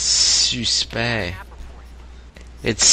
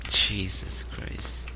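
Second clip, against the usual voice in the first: slow breathless whisper; whispered disbelief; 3 semitones lower, 9 dB quieter, much slower than usual. Real, not acted. Reacting to the office story, slow whispered disbelief. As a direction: breathe it out under your breath.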